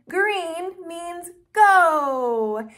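slow teaching. Shown as a demonstration. Teaching traffic light colors.

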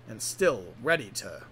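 ordinary delivery, nothing marked

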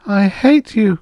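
high, moaning voice; moaned. A narrator's reading. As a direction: moan it out, pitched far up. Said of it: "she moaned".

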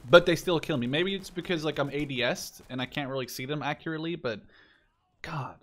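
ordinary talk, nothing marked

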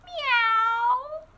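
high drawn-out meow; cat meow. Acted. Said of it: "said Cat".

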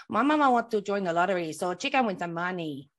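put-on Mexican accent. Shown as a demonstration. Co-host showing off an accent with a joke, mock Mexican accent. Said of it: "I do a very good Mexican".

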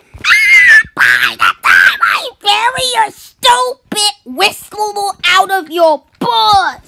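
high, loud screeching threat; screeching monster threat; monster scream. Acted.

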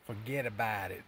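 put-on light New York accent on a stock phrase; brief mock New York tough guy. Vlogger imitating bookstore guy from New York, light New York accent. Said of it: "thick New York accent".

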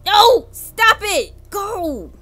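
loud, high panicked cry; being chased. Real, not acted. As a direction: yell it out while being chased.

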